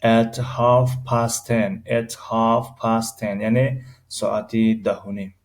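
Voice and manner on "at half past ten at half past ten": My birthday is in June را خیلی آهسته و شمرده بگو; کلمه به کلمه، آرام برای شاگرد; slow model reading. Shown as a demonstration. (جملهٔ نمونه با سرعت بسیار کند)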